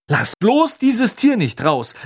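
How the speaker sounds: high, loud, drawn-out warning; urgent plea in rising alarm. A narrator's reading. Ron warns Hermine not to free Krummbein, alarmed.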